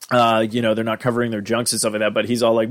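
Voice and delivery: ordinary talk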